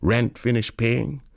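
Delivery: plain reading voice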